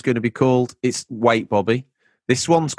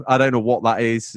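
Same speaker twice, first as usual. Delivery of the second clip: usual speaking voice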